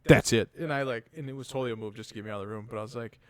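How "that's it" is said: loud, firm end of the talk; stern cutoff. Retold or imitated. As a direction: shut it down before you laugh. Dad ending talk, holding laugh.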